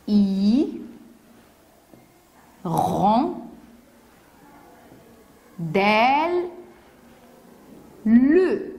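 four isolated beats: "I, ron, del, le"; long pause after each of "I, ron, del, le"; syllable by syllable. Shown as a demonstration. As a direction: draw out each syllable alone, "le" sounded at the end. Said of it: "Quatre syllabes".

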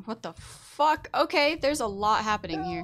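loud high startled panic; being chased. Real, not acted. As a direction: yelp it out in alarm. Another mob appears while chased.

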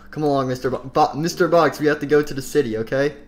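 plain reading voice

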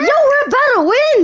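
high hyped call; hyped gamer bro. Real, not acted. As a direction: yell it out, pumped. Hyped about winning.

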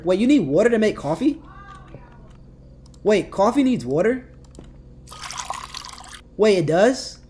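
loud, high, incredulous questions; astonished. Real, not acted. Player learning that coffee needs water, astonished. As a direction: ask it in loud disbelief.

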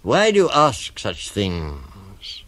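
slow, drawn-out crocodile question; coaxing crocodile. Acted. Crocodile questions the elephant child, slow and drawn out. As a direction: stretch the words out slyly. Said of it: "said the crocodile".

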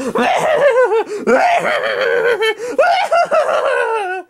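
very high hysterical cackle; shrill laughing fit. Acted. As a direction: laugh until it squeals. Laughing at bad cat joke.

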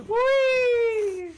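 high, loud squeal of delight; delighted shriek; gleeful squeal. Real, not acted. Squealing while playing.

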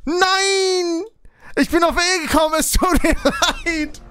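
high-pitched frantic protest; panicked wailing. Real, not acted. Player apologising for a misclick, panicked high wail. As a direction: wail it in real dismay. Pressed wrong key by mistake.

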